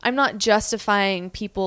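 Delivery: ordinary talk, nothing marked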